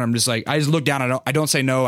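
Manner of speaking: ordinary talk, nothing marked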